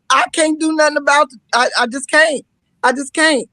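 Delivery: loud, fast, sassy telling-off; retold comeback. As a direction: snap back at the husband, hammed-up. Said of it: "I'm like".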